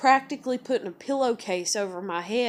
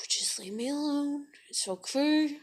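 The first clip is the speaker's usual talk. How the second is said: weary higher whisper; 7 semitones higher than usual. Retold or imitated. Exhausted mom to kid.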